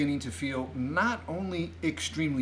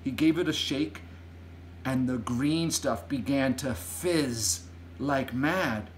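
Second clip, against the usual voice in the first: ordinary delivery, nothing marked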